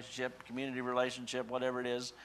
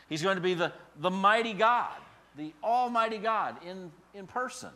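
higher and louder proclamation; proclaiming; 8 semitones higher, 8 dB louder than usual. Acted. Preacher exalting Jesus before the church. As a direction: lift up the mighty God.

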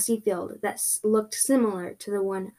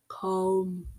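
low, soft, drawn-out summons; creepy voice; 3 semitones lower, 6 dB quieter than usual. Acted. Creepy voice calling from the door, low and soft. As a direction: beckon eerily, low and hushed. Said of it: "it said again".

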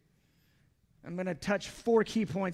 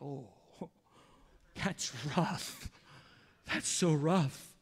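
James and John's reaction; 3 semitones lower, slower than usual. Retold or imitated. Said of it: "James and John are probably like".

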